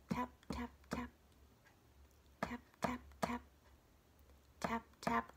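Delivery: soft, staccato taps with gaps; tapping sound. A narrator's reading. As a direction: say each like a tiny knock. Imitates tapping inside the egg.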